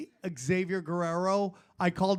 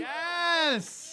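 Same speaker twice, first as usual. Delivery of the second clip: high, drawn-out whoop of approval; gleeful cheer; 9 semitones higher than usual. Real, not acted. Co-host cheering the end of the world, gleeful.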